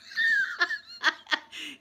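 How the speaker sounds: very high, crying-with-laughter shriek. Real, not acted. Laughing at the Bigfoot headbutt joke, very high shriek. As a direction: howl at own joke. Joke about Glasgow kiss.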